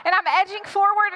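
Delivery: ordinary talk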